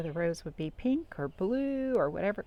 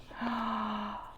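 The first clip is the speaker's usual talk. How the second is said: gasp; 4 semitones higher, 6 dB quieter than usual. Acted. Sneeze build-up in story.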